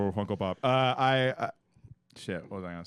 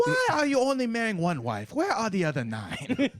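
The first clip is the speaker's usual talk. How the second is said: mock grandpa; 7 semitones higher than usual. Voicing African grandpa.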